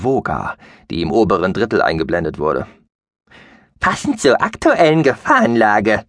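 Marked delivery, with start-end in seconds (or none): 3.8-6.0 high, piping little creature voice; squeaky mouse-beaver voice. Acted. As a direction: squeak it out like a tiny furry alien. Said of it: "piepste er".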